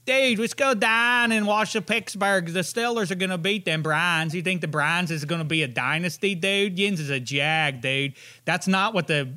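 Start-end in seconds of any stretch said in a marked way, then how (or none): 0.1-8.1 rough attempt at Pittsburgh dialect; mock Pittsburgh accent. Shown as a demonstration. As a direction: imitate a Pittsburgh Browns-hater. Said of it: "what they sound like".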